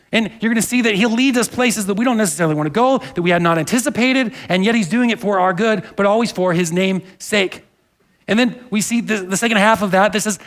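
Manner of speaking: usual speaking voice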